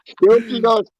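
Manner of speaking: normal talking voice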